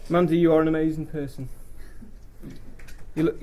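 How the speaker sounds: ordinary talk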